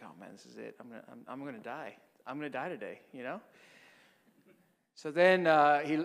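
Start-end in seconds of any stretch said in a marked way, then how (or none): 0.0-3.0 low, very soft resigned muttering; hushed dread. Retold or imitated. Priest thinking to himself he will die today, hushed dread. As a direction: retell a doomed thought under the breath.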